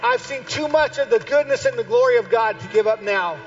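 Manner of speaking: loud, high, soaring preaching; preaching at full force. Acted. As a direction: build to a fervent close. Preaching climax.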